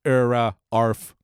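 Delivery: slow, hesitant politician's bark; Quimby. Retold or imitated. Mayor trying to win over dogs, slow stammered bark. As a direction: stammer slowly into a half-hearted dog bark. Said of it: "Quimby's involvement in this episode".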